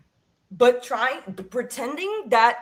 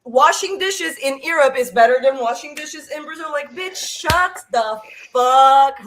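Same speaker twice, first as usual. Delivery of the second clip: higher-pitched, exasperated rant; heated rant; 4 semitones higher, 5 dB louder than usual. Real, not acted. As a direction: rant indignantly, pitch climbing. Heated rant about snobbish expats.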